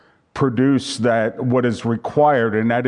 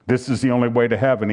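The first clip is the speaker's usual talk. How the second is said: ordinary talk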